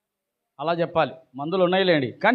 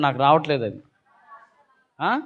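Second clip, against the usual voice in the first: usual speaking voice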